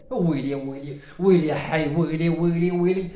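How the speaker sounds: low, moaning wail of woe; hammed-up lament, deep and soft; mock wailing lament. Lamenting his missed chance.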